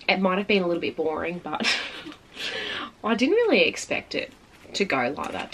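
normal talking voice

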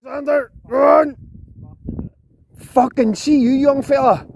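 high, loud, slow call across the water; shouting across. Real, not acted. Angler calling to his friend hooking a fish, loud shout.

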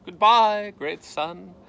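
loud, high, mock-dramatic goodbye; tragic farewell. A narrator's reading. Tifky facing death, a hammed-up tragic goodbye. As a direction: bid the world a grand doomed farewell. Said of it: "said Tifky in his mind".

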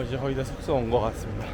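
ordinary talk

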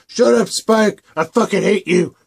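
loud, high furious yell at Spike; angry shout. Acted. Twilight yelling at Spike to shut up, furious shout. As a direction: bark it at him, fed up.

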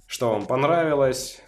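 ordinary talk

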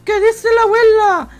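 high, loud mock-pitiful begging voice; pleading beggar. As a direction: beg her like a starving man. Beggar pleading to old lady.

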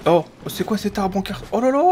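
high surprised exclaim. Real, not acted. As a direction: gasp it out in surprise. Surprised by weird tree.

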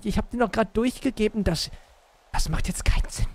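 high, whimpering sobs breaking through; acted crying. Hostage pleading with his captors, high tearful whimper. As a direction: whine it out like a captive about to cry. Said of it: "Hör auf zu weinen".

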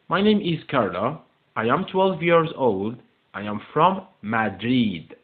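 slow English with drawn-out words; slow model reading. Shown as a demonstration. Teacher reading the text aloud to pupils, slow model reading. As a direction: take each word slowly for learners.

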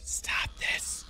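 hushed frightened whisper; scared whisper. Real, not acted. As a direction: breathe it out in fear.

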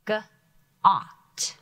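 "G-ot." split into G and a drawn-out ot; sounded out. Shown as a demonstration. Teacher sounds out a word for students. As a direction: sound out "G-ot." slowly, sound by sound. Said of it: "Short vowels".

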